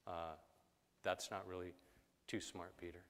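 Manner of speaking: low, quiet, hesitant objection; lightly voiced skeptical disciple; doubting disciple. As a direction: mutter a halting doubt under your breath. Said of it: "Other disciple".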